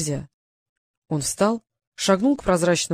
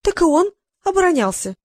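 high, louder stubborn comeback; stubborn retort; 10 semitones higher, 4 dB louder than usual. A narrator's reading. Annette arguing with the god, stubborn high retort. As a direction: refuse to back down. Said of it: "не сдавалась Аннет".

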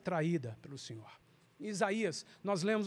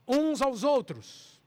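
UNS AOS OUTROS slow, louder and higher; emphatic phrase; 5 semitones higher, 5 dB louder, much slower than usual. Real, not acted. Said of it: "eu destaquei a palavra". (ênfase em "uns aos outros")

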